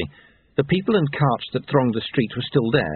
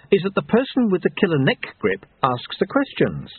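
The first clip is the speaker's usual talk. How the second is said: higher-pitched, coolly authoritative woman; stern female captor; 6 semitones higher than usual. Acted. Woman holding an intruder by the neck, stern. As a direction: lay down the rules, pitched up. Voices Susan gripping Lobsang's neck.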